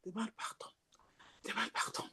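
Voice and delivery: soft unvoiced whisper of the Spirit. The gentle Spirit whispers to the believer, in a whisper. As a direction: breathe it like the Spirit, barely audible. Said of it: "Il fait ça".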